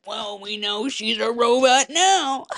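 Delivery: put-on voice of a snarky commenter; drawn-out mock know-it-all. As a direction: mimic the online comments, slow and exaggerated. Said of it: "comments that are like".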